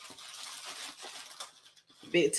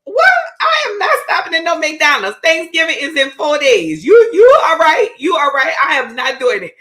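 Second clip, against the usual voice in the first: high, loud exasperated mom yelling; mock outraged mom; 9 semitones higher, 7 dB louder than usual. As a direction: shout it like a fed-up mother. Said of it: "she's like".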